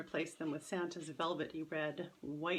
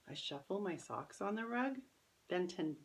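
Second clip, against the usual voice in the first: ordinary delivery, nothing marked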